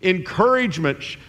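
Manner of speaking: high, half-joking plea to God; mock-begging prayer, pitched high; pleading listener. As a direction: wheedle the Lord for a bone. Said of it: "you're hoping for some".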